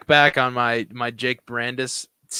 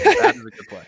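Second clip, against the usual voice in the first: much higher, goofy falsetto repeat; silly high voice; 3 dB louder than usual. Pretend. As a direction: squeak it out like a joke.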